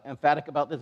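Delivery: ordinary talk, nothing marked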